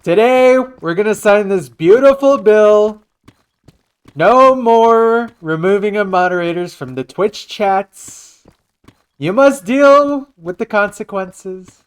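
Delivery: high, loud presidential proclamation; rough comic impression of a president's speech; Donald Trump. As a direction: stretch the opening words high and loud, then announce the rest. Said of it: "I have a great Trump impression".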